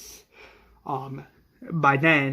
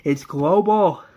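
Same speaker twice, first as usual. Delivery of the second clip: louder, rising cry on GLOBAL; horrified outburst; 3 semitones higher, 6 dB louder, slower than usual. Acted. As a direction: burst out in horror, stretching GLOBAL. Acted despair as countdown ends.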